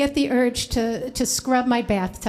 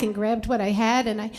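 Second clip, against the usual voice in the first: plain reading voice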